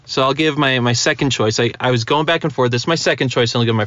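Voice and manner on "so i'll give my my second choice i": ordinary talk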